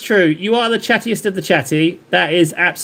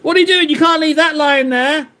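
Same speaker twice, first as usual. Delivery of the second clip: barman; 9 semitones higher than usual. Acted. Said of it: "And the barman's like".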